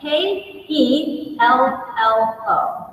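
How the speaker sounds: letters spelled slowly one by one; spelling it out. Retold or imitated. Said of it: "The City Hall clerk spelled Carol".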